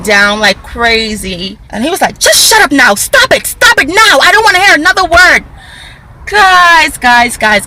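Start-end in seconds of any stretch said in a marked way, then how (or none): 2.2-7.7 high, loud mock yell from an angry old man; retold shouting. Old man in the rain shouting at the vlogger, mock yell. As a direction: yell him down, pitched way up.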